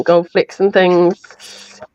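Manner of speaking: normal talking voice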